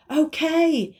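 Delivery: loud high call together; shout. Acted. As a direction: shout it out at once. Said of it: "they all shouted".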